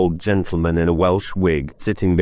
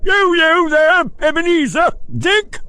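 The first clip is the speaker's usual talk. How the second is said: much higher, louder hearty calling; jovial fat voice; 3 dB louder than usual. Acted. Fezziwig calling his apprentices, jolly and hearty. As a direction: call out like a rich, jolly old master. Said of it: "comfortable, oily, rich, fat, jovial voice".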